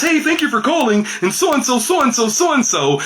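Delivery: very high, put-on professional phone greeting; mock a professional caller in a high voice. Shown as a demonstration.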